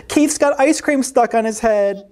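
high mocking giggle into the words; laugh, then tease in a high cat voice; chuckling at a friend's expense. A narrator's reading. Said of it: "chuckled the other cats".

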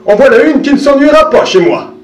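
put-on a contented old man thinking aloud; old man's satisfied thought. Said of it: "pensait le vieil homme".

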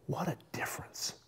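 whispered, very soft; hushed awe; hushed reflection. Real, not acted. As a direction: let it out as a whisper. Hushed reflection after father's death story.

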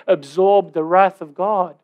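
ordinary talk

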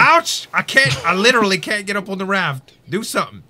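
yelling in a panic; panicked yelling. Real, not acted. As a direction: shout for help while under attack. Shark attacks him on raft.

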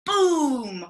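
high loud crashing boom. Shown as a demonstration. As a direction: shout it as the tower falls. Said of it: "it crashes".

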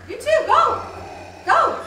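normal talking voice

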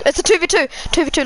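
real mid-match excitement; excited call-out. Real, not acted.

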